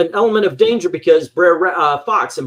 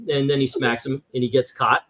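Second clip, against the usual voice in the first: ordinary talk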